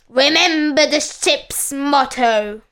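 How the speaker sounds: mild pirate roar; lightly roared threat; roaring captain. A narrator's reading. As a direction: growl out the warning as captain. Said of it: "roared the captain".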